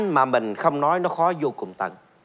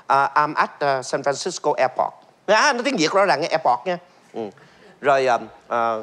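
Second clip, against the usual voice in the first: word-for-word English in Vietnamese tones; Vietnamese accent. Shown as a demonstration. Host showing fans his Vietnamese-accented English. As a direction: translate as you speak, Vietnamese-accented. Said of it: "âm điệu của Việt Nam luôn".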